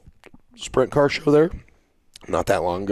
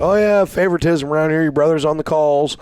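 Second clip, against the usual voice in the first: sarcastic jab; 8 semitones higher, 5 dB louder than usual. Retold or imitated. Sarcastic at rival.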